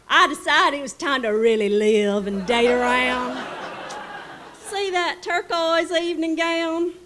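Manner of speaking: high-pitched delighted Delta lady; Southern lady voice. Retold or imitated. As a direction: play the gushing Mississippi hostess. Charlotte shows her gowns.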